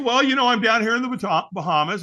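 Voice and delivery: dad playing it cool on the phone; casual dad. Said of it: "I said".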